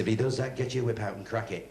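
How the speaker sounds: low, matter-of-fact ringmaster; gruff ringmaster. Retold or imitated. Ringmaster instructs the new lion tamer, low and gruff. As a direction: speak it low like a weary circus boss.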